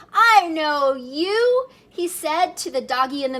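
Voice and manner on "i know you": kitten to mirror. Acted. Kitten talks to his reflection.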